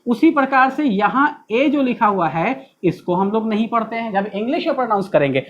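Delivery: ordinary talk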